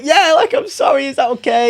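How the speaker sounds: high, loud, panicky squeak; squeaky nervous reply. Retold or imitated. Daughter coming out to her dad, nervous high squeak.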